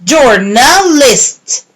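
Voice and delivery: slow, split: Jour-na-list; syllable by syllable. Shown as a demonstration. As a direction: say Journalist in its beats. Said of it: "match the syllables".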